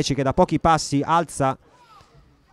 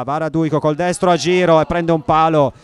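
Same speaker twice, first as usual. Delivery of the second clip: excited commentary; 2 semitones higher, 7 dB louder than usual. Real, not acted. Shot hits the post.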